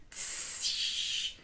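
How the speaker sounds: long, soft, voiceless "tss"; stretched sibilant with no vowel; demonstrated consonant hiss. Shown as a demonstration. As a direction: hold out the hissing consonant alone. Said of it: "like I've showed to you".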